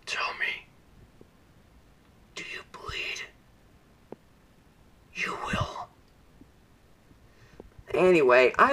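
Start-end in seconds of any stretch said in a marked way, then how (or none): 0.0-5.9 much softer menacing whisper, imitating Batman; imitating a whispered Batman threat; Ben Affleck's Batman. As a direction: hush it down to a threat in the dark. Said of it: "Ben Affleck's voice sounded like this".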